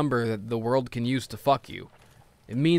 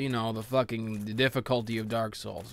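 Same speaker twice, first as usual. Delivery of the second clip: ordinary delivery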